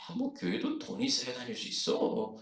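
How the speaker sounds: put-on complaining; imitated grumbling. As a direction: grumble it like a disgruntled churchgoer. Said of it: "푸념하는 거예요".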